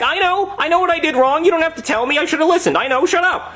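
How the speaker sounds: high, ranting retort; exasperated outburst. Retold or imitated. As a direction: cut her off in a high-pitched tantrum. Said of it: "So I throw the plate at her".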